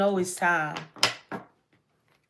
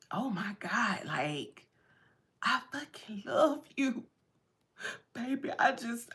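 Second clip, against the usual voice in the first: hushed, breathy whisper of love; tearful hushed whisper to a lover; whispered confession; 10 dB quieter than usual. Retold or imitated. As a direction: whisper it, overwhelmed.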